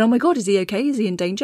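normal talking voice

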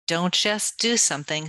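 normal talking voice

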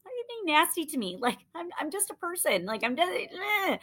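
whiny indignant quote. Retold or imitated. Quoting a mocked prospect's whine.

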